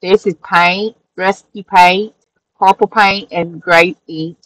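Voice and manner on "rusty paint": ordinary talk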